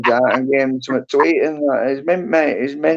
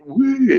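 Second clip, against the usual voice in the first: frisky whoop; 10 semitones higher than usual. Retold or imitated. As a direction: let out a quick high whoop. Imitating a frisky horse.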